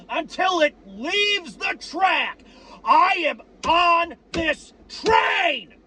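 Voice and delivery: high, slow, emphatic yell; die-hard fan vowing loyalty, shouting; fervent shouting. Real, not acted. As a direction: shout your loyalty to the rafters. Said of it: "now I'm gonna fucking yell".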